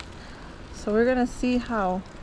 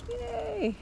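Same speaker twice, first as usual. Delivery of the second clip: excited yay; 12 semitones higher, 7 dB quieter than usual. Real, not acted. Excited about a fishing spot.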